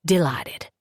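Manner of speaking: gentle Southern drawl, unhurried; a big, courtly Southern gentleman, spoken softly; soft southern accent. Acted. Big Southern gentleman greets the homeowner, soft southern accent. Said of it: "in a soft southern tongue".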